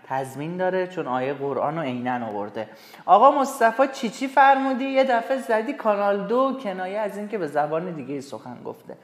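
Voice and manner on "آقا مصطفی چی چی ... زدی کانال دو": high, loud, puzzled cry; acting baffled at the strange words; mock surprised. Student asks Mostafa in mock surprise, higher and louder. Teacher voices a puzzled character.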